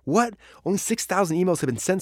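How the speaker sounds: a startled what at the screen, genuinely surprised; shocked. Real, not acted. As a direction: jolt of disbelief. Shock at the low count.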